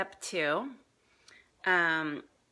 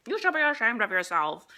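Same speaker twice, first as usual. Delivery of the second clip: mild mock old-lady scold, a bit louder; tut-tut like a prim old lady; lightly voiced scolding granny; 5 semitones higher, 9 dB louder than usual. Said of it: "people in old lady costumes being like".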